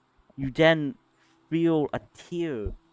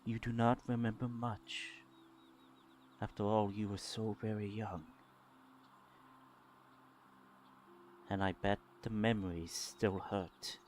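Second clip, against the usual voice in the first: low, hushed and gentle; soft calming voice; 4 semitones lower, 12 dB quieter than usual. Acted. Mysterious remembered voice recalling painful early memories, soft and calming. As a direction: speak softly and low, with tender pauses. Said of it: "It's a calming voice".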